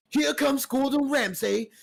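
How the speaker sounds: ordinary talk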